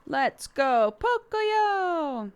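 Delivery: high singsong let's go; cartoonish cheer; singsong hype. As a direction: sing it out playfully.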